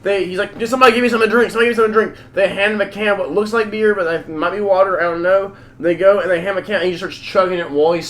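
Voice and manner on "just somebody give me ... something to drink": urgent begging. Retold or imitated. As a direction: mock-desperate plea, higher and louder. Hostage begging for a drink.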